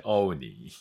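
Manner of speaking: "オーニー" stretched in English fashion; English pronunciation. Shown as a demonstration. Teacher repeating the English pronunciation of oni, long English vowels. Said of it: "英語の発音だと".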